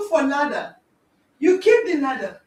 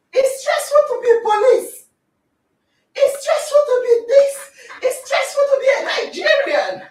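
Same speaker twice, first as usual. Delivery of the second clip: laughing rant; 7 semitones higher, 5 dB louder than usual. Real, not acted. Laughing rant about stress.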